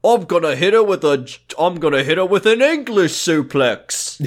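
hammed-up Cockney wrestling threat; silly Cockney villain; mock Cockney. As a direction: threaten in a loud fake Cockney. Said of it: "Australian and Cockney".

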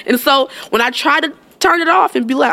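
ordinary delivery

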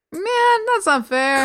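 high, whining sympathy; high-pitched "no fair" whine; whiny protest. Real, not acted. Mock protest at couple's bad luck.

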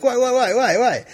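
high, puzzled repeated why; mock baffled questioning; confused celebrity. Celebrity confused by his reaction.

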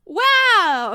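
loud, high shout of delight; excited wow. Real, not acted. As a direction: whoop at the great dice roll. Player sees her big roll.

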